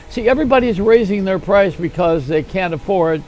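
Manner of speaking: usual speaking voice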